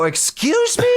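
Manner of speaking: retold outrage at being scolded; indignant excuse me. Talking back to the truck driver, incredulous. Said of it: "I go".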